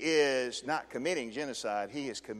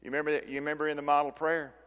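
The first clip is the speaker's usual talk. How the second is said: ordinary delivery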